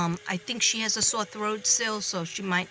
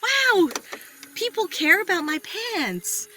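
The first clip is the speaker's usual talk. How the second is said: high, slow, delighted wow; amazed; 11 semitones higher, 4 dB louder, slower than usual. Retold or imitated. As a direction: gush with mock amazement. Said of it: "I was like".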